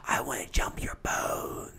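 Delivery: geflüstert, langsam und verführerisch; sultry whisper. Retold or imitated. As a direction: breathe it as a seductive offer. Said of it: "she's like".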